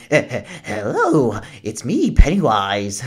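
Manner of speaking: a lightly voiced, woozy clown stammering a hello; a hint of a sloppy, drunk clown introducing himself; drunk-sounding Pennywise. Reviewer imitating Pennywise greeting the kids, lightly woozy clown. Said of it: "he sounds like almost a drunk".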